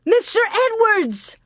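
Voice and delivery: a very high, delighted outburst; excited shout. Acted. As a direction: shout the guess with joy. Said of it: "Laura shouted".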